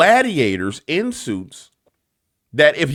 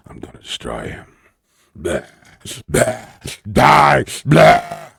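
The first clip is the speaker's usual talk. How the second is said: offended politician's threat; 4 semitones lower, slower than usual. Retold or imitated. Storming out of the room, a low mock threat ending in a cry. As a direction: grumble darkly, then blurt out die. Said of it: "Be like".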